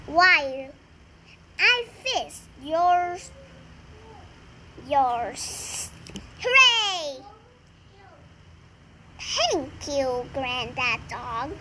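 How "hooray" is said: cheer. Acted. Family cheers new car.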